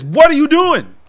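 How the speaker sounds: loud, high demanding bark; furious repeat of the question; angry coach. Retold or imitated. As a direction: coach yelling at the player. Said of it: "He says".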